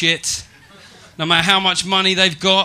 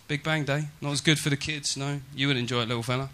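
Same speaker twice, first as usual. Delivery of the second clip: softer repeated aside; 3 semitones lower, 9 dB quieter than usual. Shown as a demonstration. Comic repeating the punchline softly to the crowd. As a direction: drop it down for the repeat.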